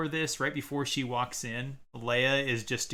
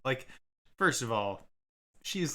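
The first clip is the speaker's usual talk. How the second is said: normal talking voice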